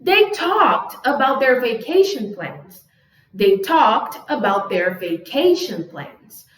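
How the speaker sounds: measured, slow classroom example; slow model reading. Shown as a demonstration. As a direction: say each word slowly for learners. Teacher reads example sentences slowly.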